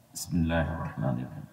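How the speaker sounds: very low, soft Arabic recitation; prayer recitation. Real, not acted. Preacher opening the grave-visiting prayer, hushed recitation.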